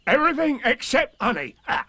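very high, emphatic tiger voice; bouncy storybook tiger; Tigger. A narrator's reading. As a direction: correct them high and sure, ending on a laughing ah. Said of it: "said Tigger".

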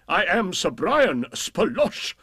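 slow lordly boast rising on Brian; hammed-up knight, rising on Brian; boastful knight. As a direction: crow the name Brian upward, grandly.

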